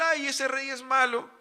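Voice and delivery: high, loud, drawn-out imitation of complainers; mock complaint. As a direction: lightly act out a loud lament. Acted complaint of the people to God.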